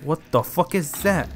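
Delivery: ordinary talk, nothing marked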